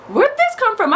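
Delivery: very high, alarmed "where'd this come from"; sudden confused alarm; startled surprise. Retold or imitated. Finding herself in a dark alley, shocked.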